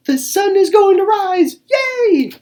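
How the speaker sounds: very high, loud, excited little squirrel; piping squirrel voice. Acted. Little squirrel cheering the sunrise, high excited piping. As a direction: squeak it out with glee. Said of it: "Little Brown Squirrel piped up".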